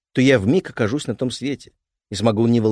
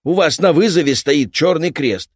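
gruff repairman; 8 semitones higher, 8 dB louder than usual. Acted. Repairman threatens.

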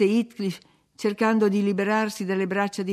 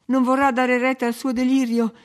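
louder, higher heated protest; impetuous; 5 semitones higher, 6 dB louder than usual. A narrator's reading. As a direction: object with a burst of feeling. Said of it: "dissi con slancio".

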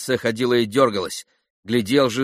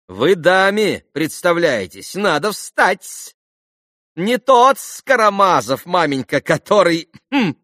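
high, loud, drawn-out formality; officious clown pitched high; buffoonish captain; 8 semitones higher, 5 dB louder than usual. A narrator's reading. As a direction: make a showy, stretched introduction.

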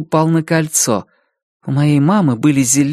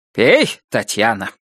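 gruff order; 7 semitones lower, slower than usual. Acted. Father-in-law ordering her to drink.

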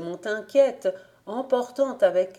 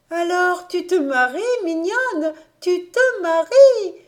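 much higher, louder joyful cry; enthusiastic; 11 semitones higher, 7 dB louder than usual. Acted. Adélaïde crying out with joy that her niece will marry, much higher and louder. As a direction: burst out with delight. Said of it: "dit Adélaïde enthousiaste".

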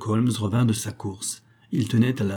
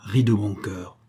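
ordinary delivery, nothing marked

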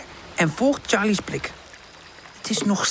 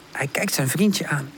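ordinary delivery, nothing marked

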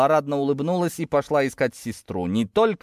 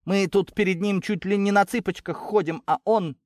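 mildly indignant, higher-pitched retort; indignant scoff; 7 semitones higher than usual. A narrator's reading. Girl answering her cousin's reproach, higher and indignant. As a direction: throw the reproach back at her. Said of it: "фыркнула Кристина".

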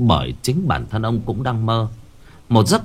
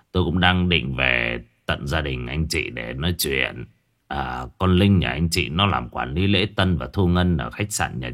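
put-on low, softer, halting older man; hesitant hotel owner; 6 semitones lower, 3 dB quieter than usual. Hotel owner tells the family their daughter stole and died, low and halting. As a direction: stammer out the bad news, lower.

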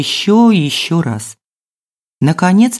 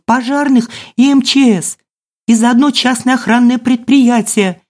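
high-pitched hysterical tirade; shrieky, high complaint kept up breathlessly without letting go; frantic woman's outcry; 8 semitones higher than usual. A narrator's reading. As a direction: wail in outrage like a frantic neighbour. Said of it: "истошный женский голос".

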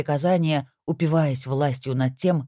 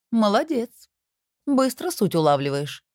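smirking praise; 8 semitones higher than usual. A narrator's reading. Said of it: "хмыкнула Антихрист".

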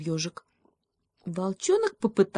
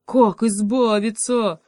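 loud, higher, drawn-out wail; panicked; 5 semitones higher, 6 dB louder, slower than usual. A narrator's reading. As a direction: wail the question in desperation. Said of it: "заметался в полной панике". (панический протяжный вопль)

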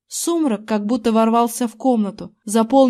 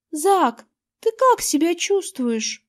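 high, slow, worried tremble; trembling voice; 7 semitones higher, slower than usual. A narrator's reading. Erin asks Zack how he feels, trembling voice. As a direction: ask timidly, higher and unsteady. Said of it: "Голос у Эрин дрожал".

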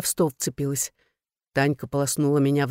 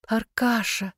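lilting, pitched-up endearment; singsong coaxing; 6 semitones higher, slower than usual. A narrator's reading. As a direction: sing the name out sweetly. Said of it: "запела я".

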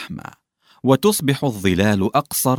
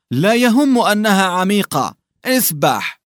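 high, louder command to swim; ordering hunchback; 9 semitones higher, 4 dB louder than usual. A narrator's reading. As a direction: snap the order back. Lubim orders him to swim. (أمر حاد بصوت عالٍ)

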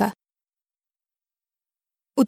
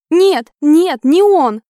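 louder, higher-pitched frantic refusal; desperate denial; 5 semitones higher, 5 dB louder, much slower than usual. A narrator's reading. Woman refusing to think her lover is dead, desperate denial. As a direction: push the awful thought away hard. Heroine refuses thought of his death.

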